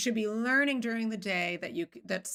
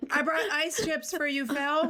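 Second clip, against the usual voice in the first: laughing; 8 semitones higher, 3 dB louder than usual. Real, not acted. Laughing while presenting ice chips.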